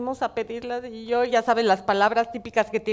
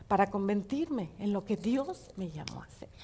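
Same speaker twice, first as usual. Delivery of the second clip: whisper; 3 semitones lower, 7 dB quieter than usual. Real, not acted. Preacher whispering to the congregation. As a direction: drop to a breathy whisper.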